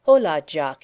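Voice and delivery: loud hearty greeting; crying out. A narrator's reading. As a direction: hail him cheerfully. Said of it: "he cried".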